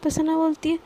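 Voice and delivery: usual speaking voice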